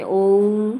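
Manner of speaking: ओम with a very long held ओ; prolonged vowel. Shown as a demonstration. Teacher showing the Sanskrit extra-long vowel, ओम prolonged. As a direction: draw ओम far out. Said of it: "समय की मात्रा बहुत अधिक".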